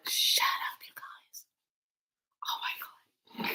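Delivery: voiceless, hushed disbelief; stunned whisper. Real, not acted. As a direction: whisper it in real shock. Stunned by the card drawn.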